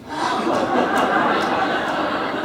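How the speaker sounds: silent shaking laugh; unvoiced laugh. Real, not acted. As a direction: laugh under your breath. Laughs at own joke.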